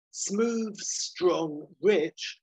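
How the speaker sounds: slow, punchy trailer read; trailer tagline. Pretend. Reading the sequel's tagline, trailer style. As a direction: announce it like a movie poster.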